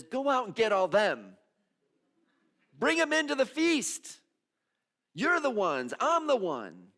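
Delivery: high, loud, joyful calling out; master's call. Acted. Master calling the messy people to the feast, loud and high. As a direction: throw the invitation wide and loud. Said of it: "And the master says".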